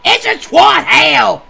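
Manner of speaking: slow, high, loud yell; shouted punchline. Acted. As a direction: bellow it out.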